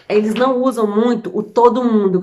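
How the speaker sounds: ordinary talk, nothing marked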